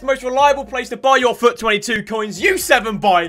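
loud, punchy sponsor read. Acted. Selling a coin site to viewers, ad read. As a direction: pitch it loudly like an advert.

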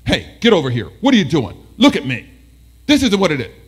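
loud, sharp telling-off; daytime-voice scolding. Acted. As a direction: call the student out at full volume. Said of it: "You're using your daytime D J voice for something negative".

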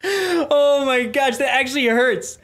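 breathless from laughing; laughing hard. Real, not acted. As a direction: talk through the tail of a laughing fit. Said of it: "I'm laughing so much that it hurt".

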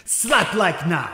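slow, drawn-out and loud; dramatic slow. Pretend. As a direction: drag out each word for drama. Dramatic call to slap like.

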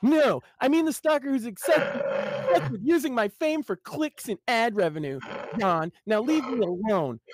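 high, indignant whine; defensive Jimmy. Acted. Jimmy snapping at his brother, high and indignant. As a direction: snap back high and offended. Jimmy snaps back, higher.

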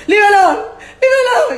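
very high shouted dismissal; retold loud protest. Woman mimicking her first angry protest, shouted. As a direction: replay the earlier yell at full pitch. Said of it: "the voice done the, from".

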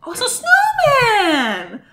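high, stretched cry of delight; high, gleeful discovery; delighted squeal. Real, not acted. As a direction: squeal slowly with a laugh in it. Spots a snowman in the game.

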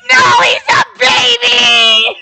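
high, loud, slow whining cry; mock dismayed wail. Real, not acted. Reincarnated SpongeBob is a baby.

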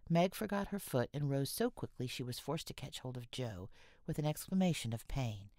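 usual speaking voice

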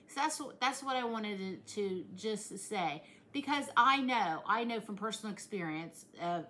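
normal talking voice

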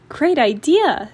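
eager. Acted. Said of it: "said Sister".

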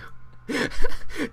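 high, airy chuckle; breathy laugh. Real, not acted. Laughs at his own Canadian joke.

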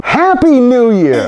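bright, high, slowed Happy New Year; cheery greeting. Real, not acted. Vlogger greeting his viewers, high and cheery. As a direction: sing it out like a party toast.

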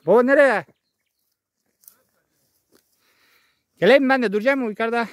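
loud high call to father. Real, not acted. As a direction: call out across the field. Calling to father far off.

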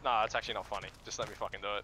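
low, serious irritation; real annoyance, pitched right down; fed up. Real, not acted. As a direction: drop the voice, done with the joke. Fed up, stops joking with friends.

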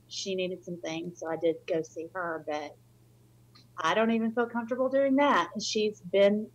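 ordinary talk, nothing marked

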